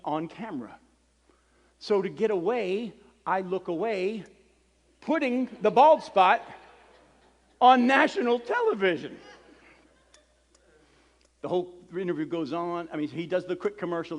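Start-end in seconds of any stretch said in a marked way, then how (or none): 5.0-9.2 raised voice, milking the embarrassment; loud exclamation. Real, not acted. Punchline of his embarrassing story.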